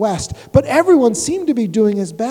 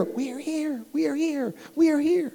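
faint, far-off tiny voices crying higher; mildly scared little townsfolk calling up; a touch of frightened crowd; 5 semitones higher, 6 dB quieter than usual. Acted. Whoville folk calling up to Horton, a touch of fear, higher. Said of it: "They cried out in fear".

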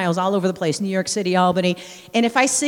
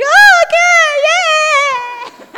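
much higher and louder, slow squeal; mock-cheerful shock while crushed on the bus; startled yay; 12 dB louder, much slower than usual. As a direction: squeal like a stranger just grabbed you. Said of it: "And I was just like".

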